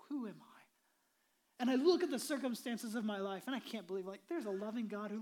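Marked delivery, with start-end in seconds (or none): 0.1-0.6 whispered doubt. Acted. Doubting listener's inner question.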